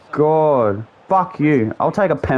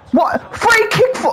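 high, incredulous yelp at the call; outraged protest. Real, not acted. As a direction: shoot up high in angry disbelief. Protests a referee decision mid-match.

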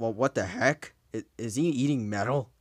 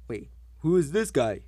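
ordinary talk, nothing marked

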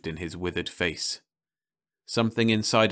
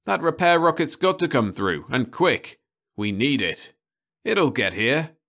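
higher, louder, insisting the rocket will come; glaring insistence; 7 semitones higher, 5 dB louder than usual. Acted. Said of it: "glaring at the old man".

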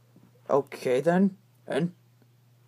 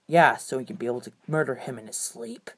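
low menacing voice; 8 semitones lower, faster than usual. Acted.

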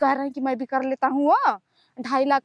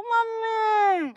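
long, higher, sweetly drawn mummy; coaxing mummy; 7 semitones higher, much slower than usual. Acted. Daughter coaxing her mother not to act like a mother-in-law, drawn out. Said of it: "सास जैसे मत हुआ करो".

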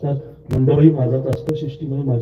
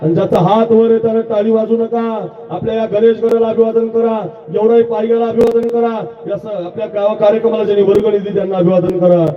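rousing preaching; 7 semitones higher than usual. Acted. Kirtankar leading village crowd in salutations, fervent high calls. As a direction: whip the crowd into answering.

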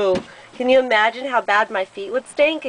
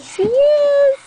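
high, loud delighted squeak; very high, louder playful affirmation; delighted squeal; 5 dB louder than usual. Real, not acted. Parent delighted at child's answer.

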